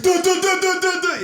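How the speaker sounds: Leonardo DiCaprio fumbling. Retold or imitated. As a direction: sputter like an actor who lost his line. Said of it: "Leonardo DiCaprio when he fumbled the line".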